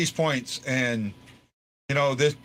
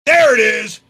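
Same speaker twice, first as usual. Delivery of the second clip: loud, high burst of joy as the puck goes in; excited shout; 7 semitones higher, 13 dB louder than usual. Real, not acted. Fan watching the winning goal go in, excited shout. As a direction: yell it out, the goal just went in.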